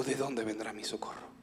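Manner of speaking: very soft, low plea; hushed despair. Acted. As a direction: fade into a near-whisper, searching for help. Quotes psalm as despairing question. (súplica desolada casi susurrada)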